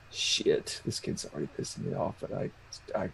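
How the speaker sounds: very low, hushed grumble; grumbling aside. Acted. Player fed up with the kid, hushed aside. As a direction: grumble it under your breath, barely voiced.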